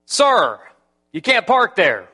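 loud, high, slow warning; yelling warning. Retold or imitated. Guard shouting at the pastor not to park there. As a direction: stop him in his tracks.

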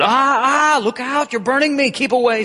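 high, loud panicked cry; panicked shout. Acted. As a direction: cry out as if burned. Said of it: "said the ghost, retreating".

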